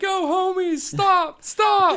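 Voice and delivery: high-pitched call out to the gang; acted yell. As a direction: yell out high, urging them to stop. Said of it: "I'm gonna yell at them".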